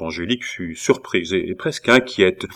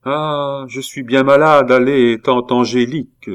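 higher, louder mock-sick whine; pitiful plea; 5 semitones higher, 6 dB louder than usual. A narrator's reading. Nephew feigning illness to head off his aunt's reproaches, pitiful plea. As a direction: angle for pity with a plaintive lament. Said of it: "essayer de se faire plaindre".

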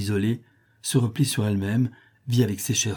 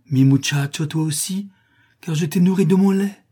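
high, loud fervent declaration; impassioned; 7 semitones higher, 5 dB louder than usual. A narrator's reading. Nurse claims the child she fed, impassioned. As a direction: build to a ringing high cry.

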